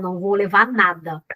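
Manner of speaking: usual speaking voice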